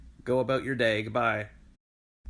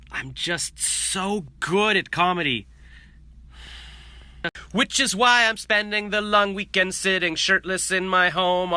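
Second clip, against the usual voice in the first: mock self-pity; 6 semitones higher, 6 dB louder, slower than usual. Mock self-pity rant, self-deprecating.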